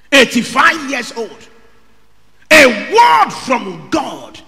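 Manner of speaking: full-force preacher's cry; preaching shout. Real, not acted. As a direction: hammer each word home to the congregation.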